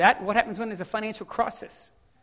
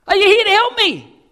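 calling up; 13 semitones higher, 14 dB louder than usual. Acted. Man on branch calls up.

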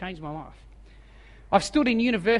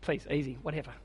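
softer, lower, breezy brush-off; faked casual calm; 4 semitones lower, 6 dB quieter, faster than usual. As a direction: pretend it's no big deal, quietly.

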